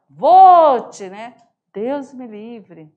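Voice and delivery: Cuiabá accent Vote; surprised exclamation. Shown as a demonstration. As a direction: gasp out Vote in shock. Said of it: "Essa admiração".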